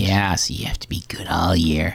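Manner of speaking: hushed, creepy whisper. Pretend. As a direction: breathe the warning like a secret threat. Ominous warning to the boy.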